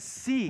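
"see" pitched high and punched; emphatic "see". Retold or imitated. Preacher stressing Peter's first word, emphatic "see". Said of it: "it's an emphatic expression".

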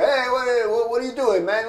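put-on high, loud Greek vendor accent. Greek vendor confronting the young photographer, loud mock Greek accent. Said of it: "the Greek accent".

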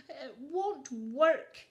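slow, sighing and defeated; sad sigh. A narrator's reading. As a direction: sigh it out, giving up. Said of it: "he sighed sadly".

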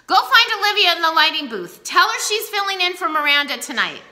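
loud, high, barking orders; angry order. A narrator's reading. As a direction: command the boy in a fury. Furious teacher ordering student.

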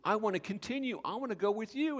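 high, loud, pleading to go along; a healed man eagerly asking Jesus to take him along. Pretend. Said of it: "he comes to Jesus".

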